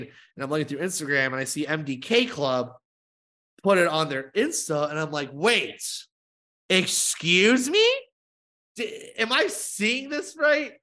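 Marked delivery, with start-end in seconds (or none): 5.3-10.7 slow, incredulous double take; stunned disbelief. Retold or imitated. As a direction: drag out excuse me in mock shock. Retold shock at seeing post.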